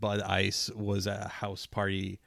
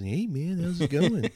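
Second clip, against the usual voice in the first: higher, easygoing stoner greeting; lightly voiced laid-back hippie; stoner dude voice; 6 semitones higher than usual. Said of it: "they all have the same impression".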